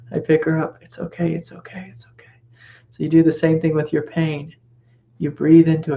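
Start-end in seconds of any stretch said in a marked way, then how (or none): 0.8-2.3 fading into a soothing whisper; calm her down, softer and softer; hushing. Retold or imitated. Soothing baby.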